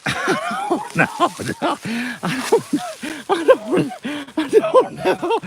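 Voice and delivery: high, helpless laughing words; cracking up mid-sentence; laughing hard. Real, not acted. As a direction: squeal it out through laughter. Cracking up at own Jackson joke.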